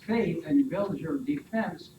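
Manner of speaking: ordinary talk, nothing marked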